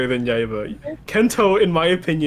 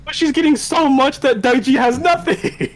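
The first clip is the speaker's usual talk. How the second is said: high-pitched loud complaint; heated fan grievance; exasperated protest; 11 semitones higher, 4 dB louder than usual. Real, not acted. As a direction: object loudly, voice pushed way up. Heated objection in fan argument.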